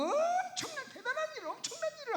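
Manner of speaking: 훨씬 높은 음으로 부풀린 감탄; 엄청난 일인 양 호들갑스럽게 흉내; 과장된 감탄. Acted. 흉내 낸 거창한 기대. (과장해서 감탄하는 말투)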